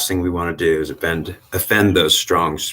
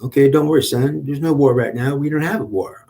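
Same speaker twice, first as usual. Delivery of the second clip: lightly voiced, higher reassuring uncle; a hint of a protective uncle calming his nephew; Uncle Bob; 8 semitones higher than usual. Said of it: "He comes around and says".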